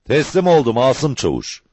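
high, carrying call of surrender; shouted surrender. A narrator's reading. Outlaw surrendering to Asım Çavuş, calling out. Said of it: "diye bağırdı".

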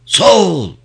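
loud, high troop shout; roared response. A narrator's reading. Group answering commander's salute, roaring. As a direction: bellow it back like soldiers on parade. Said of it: "tek bir ağızdan gürledi grup".

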